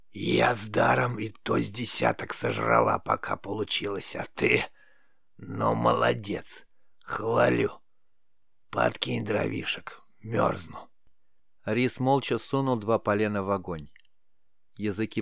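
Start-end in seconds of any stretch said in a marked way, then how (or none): 0.1-10.9 raspy crone, slowing toward the end; creaky old witch, grudging praise. A narrator's reading. Witch consoles and praises her pupil, creaky old rasp. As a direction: croak out rough encouragement.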